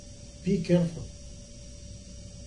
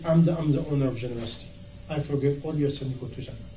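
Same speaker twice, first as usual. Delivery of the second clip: gentle divine voice; 6 semitones lower, 6 dB quieter, faster than usual. Acted. Voicing Allah's reply in story.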